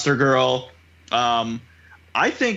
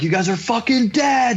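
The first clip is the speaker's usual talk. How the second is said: raised, higher-pitched furious threat, retold; mock rage; 7 semitones higher than usual. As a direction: act out an enraged hero's threat. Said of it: "representation of Mark's rage".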